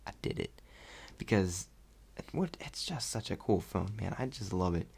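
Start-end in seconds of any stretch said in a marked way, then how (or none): 0.0-0.6 whispered self-congratulation. Retold or imitated.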